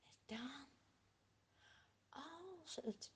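breathy storyteller whisper. A narrator's reading. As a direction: lean in and whisper. Tense pause in story.

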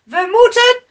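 high, loud urgent cry; calling out. A narrator's reading. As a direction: call out to the whole crew. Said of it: "riep een van de mannen". (luid en dringend roepen)

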